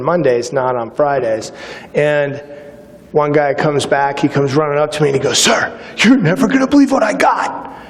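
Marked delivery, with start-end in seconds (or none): 5.4-7.8 higher, breathless with news; excited recruit. Retold or imitated. Recruit rushing to the officer with a secret, excited. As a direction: burst in like you've found treasure.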